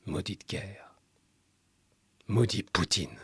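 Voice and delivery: very soft, understated cursing; low-key, hushed bitterness with a long pause between; hushed curse. A narrator's reading. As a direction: let the curse fall almost under your breath. Narrator voices curse on war.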